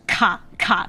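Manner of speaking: "ka" with a lot of air coming out; airy K. Shown as a demonstration. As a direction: push a strong puff of breath through "ka". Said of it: "a lot of air coming out".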